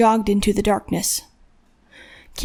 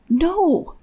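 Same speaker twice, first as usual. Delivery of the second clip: horrified; 7 semitones higher than usual. A narrator's reading.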